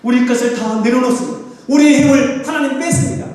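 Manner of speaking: high, fervent pulpit plea; urgent prayerful preaching; impassioned preaching. Real, not acted. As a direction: cry out in surrender to God. Voices surrender to God.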